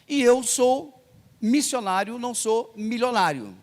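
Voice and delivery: slow, high, emphatic contrast of missionário and milionário; missionary not millionaire. Retold or imitated. Pastor insisting to the African caller he is no millionaire, slow and emphatic. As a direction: stretch out missionário and milionário slowly and pointedly.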